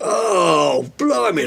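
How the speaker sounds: a sudden hammed-up ouch of pain; pained yelp. [name] yelps at the DNA sample needle, in hammed-up pain. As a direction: yelp as the sample is taken, mock hurt.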